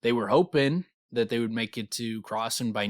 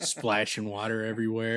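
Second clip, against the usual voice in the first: ordinary delivery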